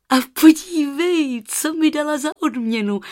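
put-on high, loud girlish excitement; eager child showing off a treasure; calling out excitedly. A narrator's reading. Girl shows aunt the golden feather, excited call. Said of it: "volala a ukazovala zlaté pírko".

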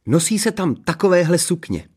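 high, gushing princess; enthusiastic. A narrator's reading. Princess describing human fashion at court, enthusiastic. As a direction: tell it high and thrilled. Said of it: "vykládala nadšeně princezna Ksenie".

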